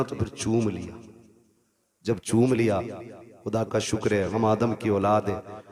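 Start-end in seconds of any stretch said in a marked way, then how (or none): none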